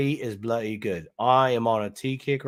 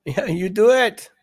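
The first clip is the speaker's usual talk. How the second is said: mock bossy; 10 semitones higher, 6 dB louder than usual. Bossy joking at co-host.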